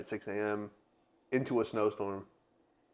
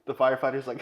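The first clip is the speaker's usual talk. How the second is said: ordinary talk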